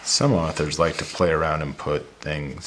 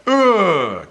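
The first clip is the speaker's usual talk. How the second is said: high, loud ugh sliding down; frustrated groan; 12 semitones higher, 5 dB louder than usual. Shown as a demonstration. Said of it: "that upset or that frustration".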